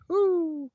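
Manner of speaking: very high, loud "woo"; whoop. Real, not acted. Streamer greeting arriving viewers, high loud whoop. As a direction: let out a high whoop of hello.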